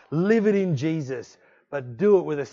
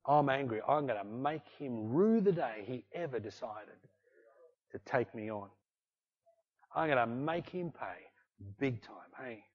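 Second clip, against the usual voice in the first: quiet resolve; 5 semitones lower, 11 dB quieter than usual. Retold or imitated. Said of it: "speak that out of my mouth".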